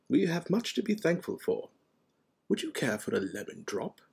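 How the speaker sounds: mild, genial wizard; Dumbledore. A narrator's reading. Dumbledore offering a lemon drop, mildly genial.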